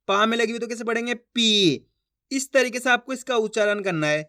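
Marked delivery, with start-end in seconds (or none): 1.1-1.9 पी drawn out on the vowel; long ee in पी. Shown as a demonstration. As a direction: hold the ई of पी. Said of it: "खींच खींच के पढ़ना है". (पी की ई खींचकर)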